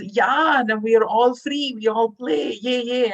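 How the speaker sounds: put-on a child shouting for joy; excited child.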